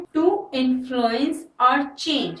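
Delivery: very slow, word by word; slow dictation. Shown as a demonstration. Teacher dictating the definition to students, very slow. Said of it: "meaning क्या है".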